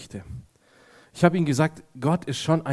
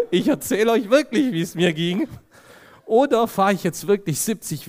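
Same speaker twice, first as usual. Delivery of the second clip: laughing; 7 semitones higher than usual. Real, not acted. Laughing at own story.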